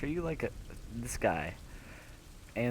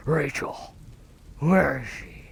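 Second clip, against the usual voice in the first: dramatic call; 4 semitones higher, 5 dB louder, slower than usual. Acted. Calling out for Rachel in game.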